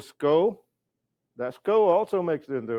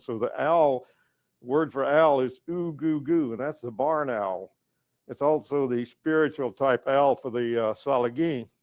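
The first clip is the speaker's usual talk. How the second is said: ordinary talk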